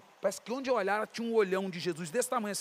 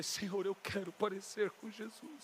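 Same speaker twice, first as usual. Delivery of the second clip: soft, slow, pleading prayer; mock tearful prayer; 9 dB quieter, slower than usual. Retelling how he cried praying to be like Jesus, mildly tearful plea. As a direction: pray softly as if weeping, lightly acted. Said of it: "E chorava".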